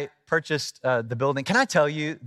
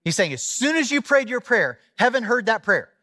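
raised-pitch sermon delivery; impassioned preaching; 7 semitones higher, 3 dB louder than usual. Real, not acted. Preacher tells congregation of Daniel's wait, impassioned. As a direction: preach the Bible story with lifted energy. Preaches on the verse just read.

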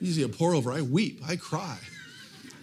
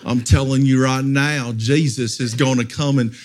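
lower, louder mock TV-preacher boom; lower, louder parody of a televangelist; Casey Treat impression; 8 semitones lower, 5 dB louder than usual. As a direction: ham up the preachy TV evangelist. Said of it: "he talked like this".

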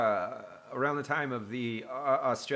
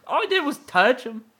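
mock hurt Steve Irwin; 12 semitones higher, 7 dB louder than usual.

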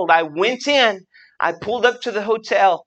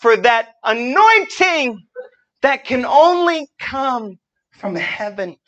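slow, drawn-out preacher build; preaching; 6 semitones higher, 3 dB louder, slower than usual. Acted. Preacher calling the room toward the anointing, slow and rising. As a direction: reach for the congregation slowly.

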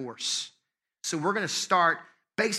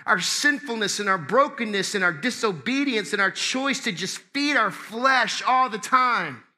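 higher, emphatic sermon delivery; impassioned preaching; 5 semitones higher, 4 dB louder, slower than usual. Real, not acted. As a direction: proclaim it to the congregation with stretched key words.

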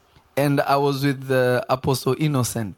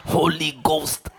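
imitated praying preacher; slower than usual. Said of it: "So the man was".

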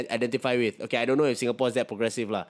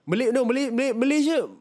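excited; 11 semitones higher, 3 dB louder than usual. Real, not acted. Excited about Malaysia comparison.

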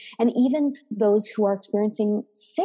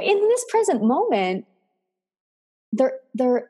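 ordinary delivery

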